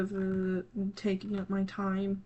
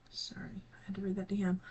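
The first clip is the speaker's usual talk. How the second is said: whispered apology; 5 dB quieter than usual. Real, not acted. Streamer apologizing to viewers while reading a DM, whispered.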